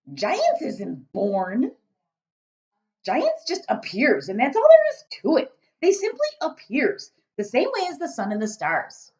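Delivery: loud, booming friendly giant; a big-hearted giant rumbling away. Acted. BFG speaking.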